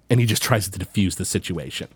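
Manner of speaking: ordinary talk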